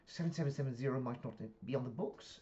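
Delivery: put-on UK-accented line, rising at the end; Fleming in British accent. Colleague doubting the suspect is on record, British accent. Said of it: "I speak in the accents 'cause it's U K".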